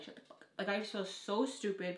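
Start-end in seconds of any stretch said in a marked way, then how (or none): 0.0-0.5 hushed embarrassed whisper; whispered plea to a laughing cousin. Real, not acted. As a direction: hiss it under your breath.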